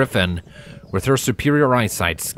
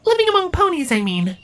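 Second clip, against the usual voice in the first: much higher creature voice, wondering; a shy outsider asking her friend; very high, curious little creature; Tibia, curious changeling. Acted.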